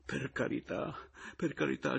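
high, whimpering entreaty; moaning plea. A narrator's reading. Rival getting up hastily and begging, moaning. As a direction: moan it twice, begging for mercy. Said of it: "gemette Pomino".

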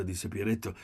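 usual speaking voice

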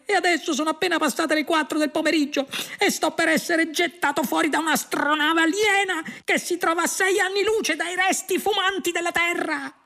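very high, hysterical rant; outraged protest. A narrator's reading. Earthman lamenting his demolished planet to his friend, hysterical rant. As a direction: rant at a high pitch throughout. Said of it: "protestò Arthur".

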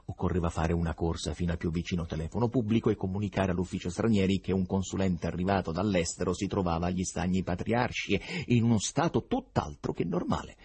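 ordinary delivery

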